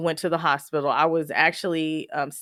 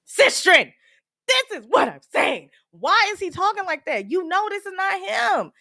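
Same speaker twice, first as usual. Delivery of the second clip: emphatic exasperation; 12 semitones higher than usual. Retold or imitated. Daughter to the doctor who knows her dad, exasperated. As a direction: cry out as if finally someone gets it.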